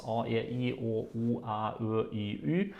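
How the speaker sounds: the vowels A, E, I, O, U, A, Ö, I, Ü recited evenly one by one; Norwegian vowel sounds. Shown as a demonstration. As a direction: list A, E, I, O, U, A, Ö, I, Ü as pure Norwegian vowels. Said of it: "Norwegian has nine vowels".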